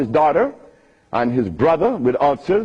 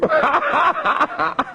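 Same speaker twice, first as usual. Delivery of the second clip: laugh; 11 semitones higher than usual. Real, not acted. Laughs after mischievous remark.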